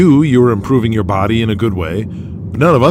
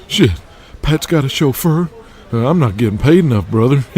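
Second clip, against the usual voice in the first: brash wrestler voice; 4 semitones higher than usual. Acted. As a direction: rib him like a cocky young wrestler.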